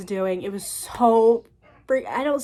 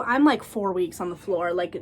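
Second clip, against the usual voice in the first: ordinary delivery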